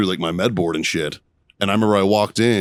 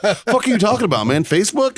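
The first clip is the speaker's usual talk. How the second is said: high-pitched mock bafflement; baffled retort; 12 semitones higher than usual. Marine retelling his comeback to an Army medic, baffled. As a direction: act out total incomprehension, pitched way up.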